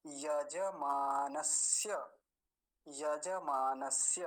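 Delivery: slow यजमानस्य, ya-ja-mā-na-sya; full ज. Shown as a demonstration. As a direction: sound every syllable of यजमानस्य, ending on clear य. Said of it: "अभी पूरा है तो क्या बोलेंगे".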